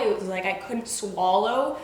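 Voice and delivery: ordinary delivery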